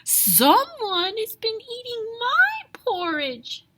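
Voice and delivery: high, slow little bear squeak; high baby bear voice. A narrator's reading. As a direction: squeak it like a tiny cub. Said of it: "squeaked Baby Bear".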